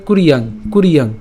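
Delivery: কুরিয়াং said as kur-yang run together; joined syllables. Shown as a demonstration. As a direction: blend কুর and ইয়াং into one word. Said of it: "একসাথে উচ্চারণ করলে". (কুরিয়াং একসাথে)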